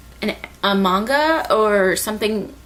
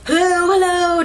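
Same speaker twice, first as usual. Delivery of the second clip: high, raised call into a radio; 9 semitones higher, 4 dB louder than usual. Retold or imitated. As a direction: call out for friends over the radio. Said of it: "trying to radio her friends".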